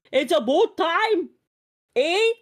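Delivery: high, drawn-out exaggerated Canadian vowels; mock Canadian accent. Mocking the accent she is accused of, exaggerated Canadian.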